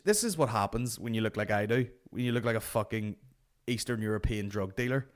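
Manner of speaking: ordinary delivery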